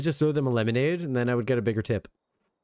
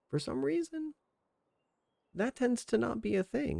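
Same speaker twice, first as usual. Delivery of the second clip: high squeaky puzzlement; 8 semitones higher, 7 dB quieter than usual. Real, not acted. Puzzled squeak about root beer.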